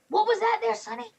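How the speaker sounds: put-on high, folksy old-timer; old man voice. Old man asking the orc to repeat himself. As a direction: squint and ask, hard of hearing. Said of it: "he goes".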